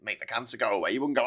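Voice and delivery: ordinary delivery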